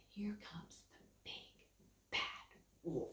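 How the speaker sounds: spooky whisper. A narrator's reading. Storyteller building suspense for the children, whispered.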